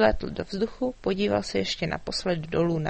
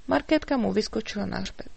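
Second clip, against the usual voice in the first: usual speaking voice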